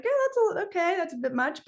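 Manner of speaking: high-pitched, hesitant, stumbling reply; retold doubtful yes, starting very high and easing down; reluctant agreement. Speaker answering God's request to tell the story, reluctant.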